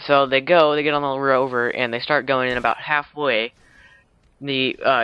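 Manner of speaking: normal talking voice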